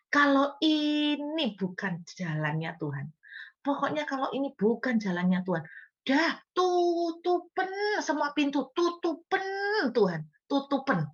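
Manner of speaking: high, pleading cry of tutupen; hammed-up anxious prayer, rising high; mock desperate prayer. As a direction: beg God to shut every door. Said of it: "kita tuh doa seperti ini".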